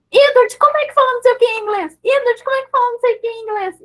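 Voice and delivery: put-on high, excited pestering of a toddler; imitated eager onlookers. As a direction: pester the little one, high-pitched and insistent. Mimics people crowding around toddler. (curiosos insistentes, voz bem aguda)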